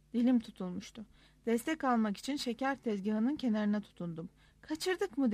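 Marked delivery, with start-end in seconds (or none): none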